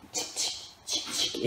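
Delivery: whispered tic tic like a camera shutter; camera click whisper. Shown as a demonstration. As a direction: click softly like taking a photo. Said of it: "On le prend en photo".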